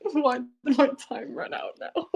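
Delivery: laughing through a retold quote. Retelling her own blitz game, laughing. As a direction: giggle out the mock complaint. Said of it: "And I was like".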